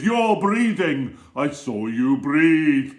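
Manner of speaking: loud, slow, high accusation; accusing teacher. Acted. Strict teacher catching a pupil breathing, loud slow accusation. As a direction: pounce on the pupil. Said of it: "she'd be right there with".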